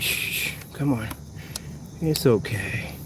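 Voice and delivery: soft shushing; quiet shush to calm the bird; hushing the bird. Real, not acted. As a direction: hush the frightened bird gently.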